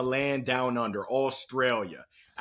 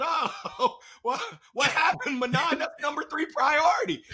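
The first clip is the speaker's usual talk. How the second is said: much higher, laughing mock disbelief; mock shock; 11 semitones higher than usual. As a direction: replay your own stunned, laughing reaction. Retelling his shocked reaction to Manan.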